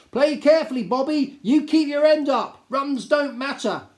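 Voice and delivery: loud, high bellow across the field. A narrator's reading. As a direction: roar it out like a pub captain. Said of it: "bellowed in a most unpoetical voice".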